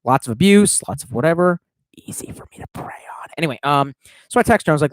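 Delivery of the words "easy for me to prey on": hushed wicked aside; a mock sinister whisper to the audience; sly whispered aside. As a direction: whisper it like a guilty secret. Creepy joking aside.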